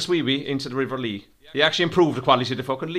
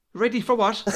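mock surprise; 11 semitones higher than usual. Feigned confusion.

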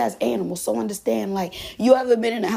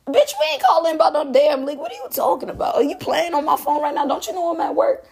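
mom's exasperated rant, pitched up; retold angry mom; 6 semitones higher than usual. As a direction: play the irritated mother at work, voice rising. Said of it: "You said".

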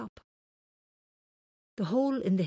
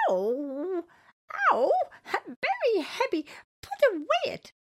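high, loud pained squeal; small creature voice; 13 semitones higher, 5 dB louder than usual. Acted. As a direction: cry out in pain, very high. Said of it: "said Elephant".

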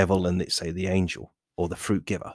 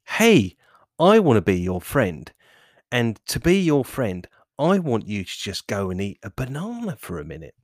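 friendly other voice; 2 semitones higher, 3 dB louder than usual. Acted. Other inner voice offering a banana as distraction, eager and friendly. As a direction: butt in loudly as a would-be friend. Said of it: "the other voice that basically says".

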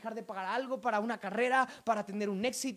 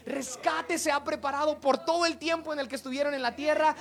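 raised, loud proclamation building in volume; full-force preaching; 5 semitones higher, 12 dB louder than usual. Real, not acted. Preacher declaring the church is ready, full-force preaching. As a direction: build to a loud sermon climax.

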